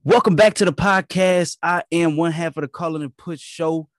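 loud, high, pumped-up host welcome; hyped podcast intro. Acted. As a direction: open the show with big energy. Hosts open the podcast.

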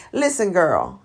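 normal talking voice

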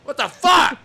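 high, loud exasperated burst; excited outburst. Retold or imitated. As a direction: blurt it out in delighted shock. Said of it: "And I said".

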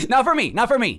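high, laughing "not for me"; laughing denial. Real, not acted. Host laughing off the 911 call, insisting it wasn't for her. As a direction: wave it off through laughter.

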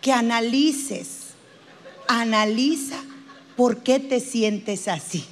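composed counsellor closing the session; psychologist wrapping up. Acted. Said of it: "Si acaba la hora y te dice".

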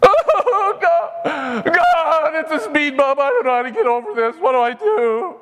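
high laughing mock wail; mock weeping. Preacher to congregation mocking a driver weeping at a bump, high laughing whine. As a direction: whine as if crying over a speed bump.

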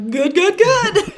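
imitating the friend's fake-fine answer, high and strained; forced cheery good. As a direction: replay the friend's unconvincing good. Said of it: "the intonation and his, like, wide-eyed stare".

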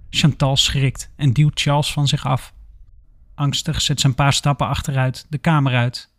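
plain reading voice

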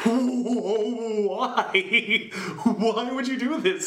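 high, wailing disbelief through laughter; drawn-out, laughing "why" of exasperation; mock anguished protest. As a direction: cry out in comic despair. Mock outraged reaction to car shutdown.

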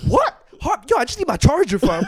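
high, loud disbelieving yelp; baffled outcry. Retold or imitated. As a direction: blurt it out in total confusion. Disbelieving shout at the girl.

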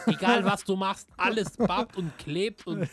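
exasperated loud complaint. Real, not acted. Complaining about sticky summer heat.

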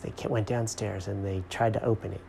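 ordinary delivery

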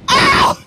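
high loud whoop; whooping cry. Real, not acted. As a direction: let out a shocked holler. Reacting to the guest's line.

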